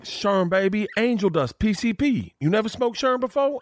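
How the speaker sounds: high, put-on raspy celebrity voice, amused; a hammed-up Tracy Morgan impression; imitating Tracy Morgan. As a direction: play Tracy surprised the newbie never had Sherm. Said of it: "I was like, "What?" ... Sherm".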